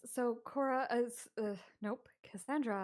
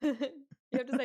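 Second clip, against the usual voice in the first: loud, higher, laughing urging; laughing through words; 4 semitones higher, 7 dB louder than usual. Real, not acted. Player telling friend how to say it, laughing. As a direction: urge them on while laughing.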